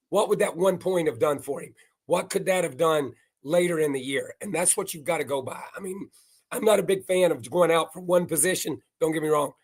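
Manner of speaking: ordinary talk, nothing marked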